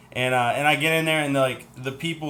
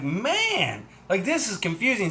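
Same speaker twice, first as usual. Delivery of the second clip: exasperated whine; 7 semitones higher, slower than usual. Retold or imitated. Lost after confusing directions.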